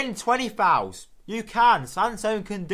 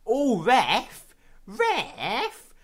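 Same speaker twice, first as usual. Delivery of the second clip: high puppet-like yelp; Sesame Street puppet; 5 semitones higher, much slower than usual. Real, not acted. Gamer appealing to the ref, sounding like a Sesame Street puppet. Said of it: "a puppet of Sesame Street".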